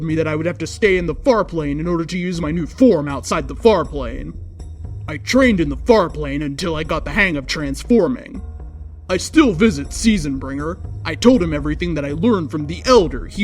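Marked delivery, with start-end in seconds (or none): none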